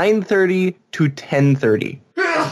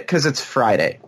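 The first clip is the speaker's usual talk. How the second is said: ordinary delivery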